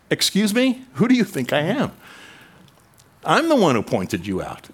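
indignant retort. Retold or imitated. John protesting to Jesus, indignant and offended. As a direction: bristle like someone snubbed by a friend.

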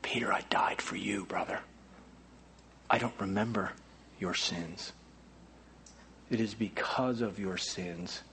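very soft, low, hushed voice of God; tender whisper. Acted. God whispers forgiveness to the failed Peter, very soft. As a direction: speak like forgiving a broken friend.

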